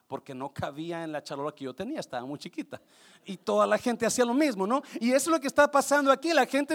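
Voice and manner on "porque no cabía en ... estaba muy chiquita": put-on low, soft old relative's voice; great-grandmother. Great-grandmother explaining her small pan, low great-grandmother voice. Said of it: "va con la bisabuela".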